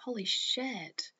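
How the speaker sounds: hushed shocked whisper. Real, not acted. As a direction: whisper it in disbelief. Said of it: "whispered shock".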